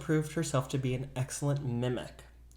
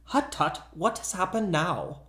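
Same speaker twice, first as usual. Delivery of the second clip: put-on Dutch accent; 4 semitones higher than usual. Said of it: "in a perfect imitation Dutch accent".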